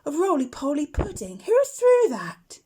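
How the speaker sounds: put-on a boy startled by a thud, calling out. A narrator's reading. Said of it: "said the boy".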